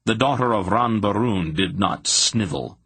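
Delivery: ordinary delivery, nothing marked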